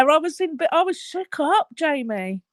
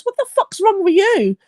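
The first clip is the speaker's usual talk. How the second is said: lightly voiced son, pitched up; imitated son; 6 semitones higher than usual. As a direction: retell the son's challenge in a higher voice. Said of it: "My son popped in and goes".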